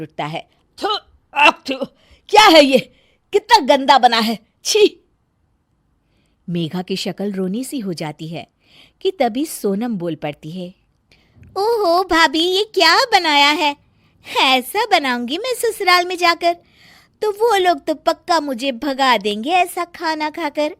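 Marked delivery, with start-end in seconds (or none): 0.8-5.0 loud, spitting disgust at the food; husband gagging on his wife's chowmein. Acted. 11.6-20.8 high, teasing mock dismay; mocking younger sister. Sonam mocks the bad food.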